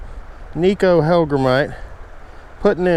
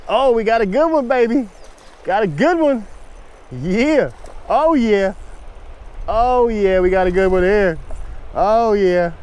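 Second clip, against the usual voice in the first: excited; 7 semitones higher than usual. Real, not acted. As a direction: whoop it up as the fish fights. Fighting a big fish on the line.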